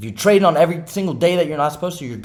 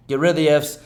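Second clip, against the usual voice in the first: ordinary delivery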